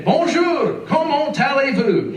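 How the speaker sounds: put-on high, loud French in a Southern Illinois accent. Student greeting French-speaking ladies, loud Southern Illinois accent. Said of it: "My best Southern Illinois French".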